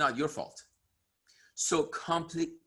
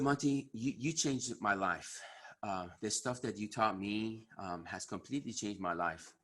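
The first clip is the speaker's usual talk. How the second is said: emotional; 5 semitones lower, 8 dB quieter than usual. Real, not acted. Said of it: "I'm getting emotional right now".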